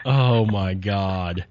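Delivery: ordinary delivery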